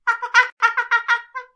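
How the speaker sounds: loud, high staccato laugh. Real, not acted. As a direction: burst into high, rapid laughter. Reacts to what she read.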